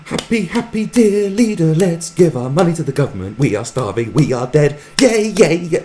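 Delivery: mock ecstatic propaganda singing, loud and high; hammed-up shrill cheering chant; deliriously happy. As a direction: imitate a girl in frenzied joy. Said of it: "absolutely deliriously happy".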